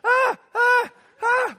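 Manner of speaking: high, loud imitated yelps; three piercing mock wails; imitated child's wail. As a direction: mimic an upset kid's repeated cries. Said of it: "knocks on the window and goes".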